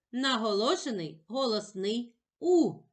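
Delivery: normal talking voice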